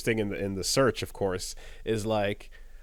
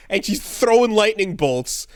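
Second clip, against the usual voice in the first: usual speaking voice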